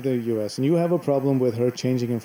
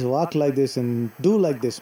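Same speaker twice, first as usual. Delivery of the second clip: ordinary delivery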